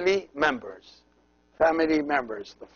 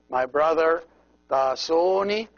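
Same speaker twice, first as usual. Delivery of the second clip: normal talking voice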